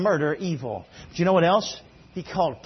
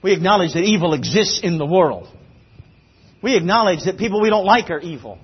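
loud, raised preacher's voice; punchy, high-energy sermon delivery; full-force preaching; 4 semitones higher, 9 dB louder than usual. Real, not acted. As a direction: drive it out to the whole congregation.

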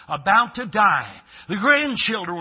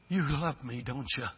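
much softer, a quiet broken question; softened dying man; 5 semitones lower, 9 dB quieter than usual. Retold or imitated. A dying man, moved by her tears, asks softly. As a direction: let the hard man melt into a hush. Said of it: "he said".

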